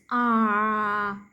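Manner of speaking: long, steady held vowel "ah"; voiced ah. Shown as a demonstration. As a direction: hold "ah" on a level tone so the vocal cords vibrate. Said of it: "vocal voice vibrate by this".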